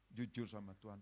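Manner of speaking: ordinary talk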